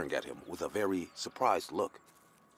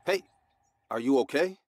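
startled, higher and louder; jolted, calling out in surprise; 8 semitones higher, 7 dB louder than usual. A narrator's reading. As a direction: caught off guard by a grabbed hand. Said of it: "I got a bit startled and said".

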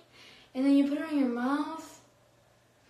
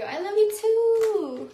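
sweet reply; 8 semitones higher, 6 dB louder, slower than usual. Acted. Reading a chat message aloud.

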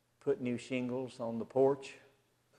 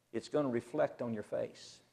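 ordinary delivery

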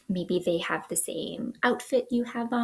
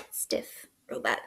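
robot voice; 8 dB quieter than usual. Shown as a demonstration. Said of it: "show me your robot moves".

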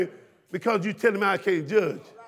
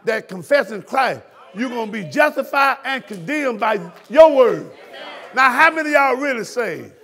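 preaching; 3 semitones higher, 5 dB louder than usual. Acted. Preacher drives scripture home to his congregation, loud.

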